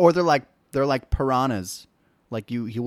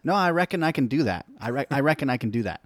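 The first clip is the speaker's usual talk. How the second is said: quick, breezy imitated Australian accent; mimicking an Australian actress, fast and casual in an Australian accent; 4 semitones higher, 3 dB louder, much faster than usual. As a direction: toss it off in a light Australian accent. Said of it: "in her Australian accent".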